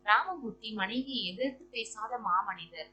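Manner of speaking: ordinary delivery, nothing marked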